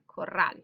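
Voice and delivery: "corral" leaning on the final "rral"; stress on last syllable. Shown as a demonstration. Teacher repeating the example, "corral" stressed on last syllable. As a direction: land firmly on the end of "corral". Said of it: "You can hear the stress is here".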